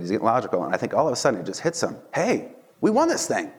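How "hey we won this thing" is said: high gleeful cry of victory; triumphant cheer. Retold or imitated. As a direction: shout it like you just won. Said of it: "he starts talking trash".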